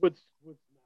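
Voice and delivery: muffled, dropping out; muffled bass only. Real, not acted. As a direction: sound like a bad muffled mic line. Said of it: "you sound a little muffled".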